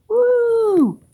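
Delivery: high gleeful whoop; excited woo. Acted. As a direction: cheer it like a happy kid. Cheering helicopter flight.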